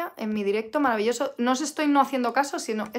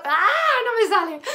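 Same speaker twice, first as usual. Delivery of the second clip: sudden high, loud "aah" of exasperation; frustrated yelp; 8 semitones higher, 6 dB louder than usual. Real, not acted. Teacher who can't get the word out, exasperated yelp. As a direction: cry out at your own slip, still high on the complaint.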